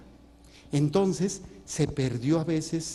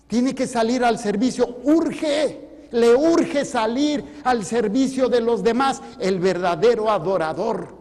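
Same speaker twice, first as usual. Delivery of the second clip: louder and higher, hitting urge hard; urgent exhortation; 6 semitones higher, 6 dB louder than usual. Acted. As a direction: push the congregation to act. (exhortación urgente, más fuerte y aguda)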